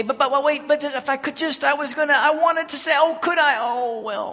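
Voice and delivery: high, loud, stammering attempts to cut in; acted frantic interjecting. God trying to interrupt our prayers, acted frantic stammer. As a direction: keep trying to get a word in, strained and urgent. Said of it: "God's trying to say".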